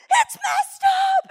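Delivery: high, loud, slow wail; child screaming. Retold or imitated. Boy screaming that his score is ruined. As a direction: scream it like a kid melting down.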